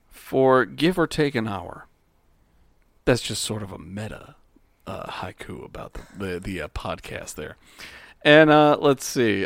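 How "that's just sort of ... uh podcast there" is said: whispered aside. Real, not acted. Muttered aside about the haiku.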